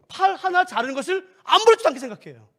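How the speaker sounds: ordinary talk, nothing marked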